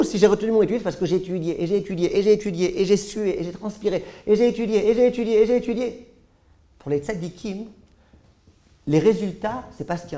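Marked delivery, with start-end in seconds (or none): 0.0-6.1 building from firm to louder and higher; insistent. Acted. The rav tells his family he only studied and sweated, building louder. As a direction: hammer each "et j'ai étudié" harder.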